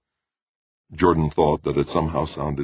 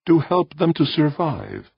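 high, measured voice of an alien being; 13 semitones higher, 3 dB louder than usual. Acted. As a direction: speak as a calm cosmic presence. Alien voice answers.

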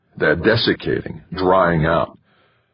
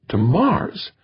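high, incredulous question; astonished wife; 11 semitones higher than usual. A narrator's reading. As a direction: squeak it in wide-eyed disbelief. Wife astonished by husband's suggestion.

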